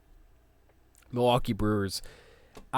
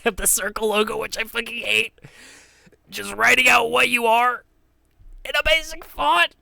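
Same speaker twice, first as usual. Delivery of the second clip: high, loud, exasperated rant; 10 semitones higher, 4 dB louder than usual. Real, not acted. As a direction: rant it out in disgust. Ranting about hated logo.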